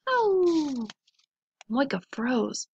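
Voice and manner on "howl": wolf howl. A narrator's reading. Unseen wolf howling back again, high howl. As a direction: howl high and let it slide down. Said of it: "Then again".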